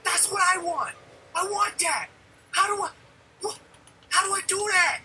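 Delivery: put-on high, eager kid's wonder; excited kid reliving the game; childlike excitement. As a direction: relive the thrill of seeing it first.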